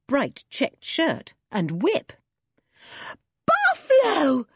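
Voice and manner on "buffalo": high, loud, drawn-out cry of relief; joyful shout. A narrator's reading. The children shout to Buffalo as he appears, high and delighted. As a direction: call his name out in delight. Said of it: "shouted all four of the children".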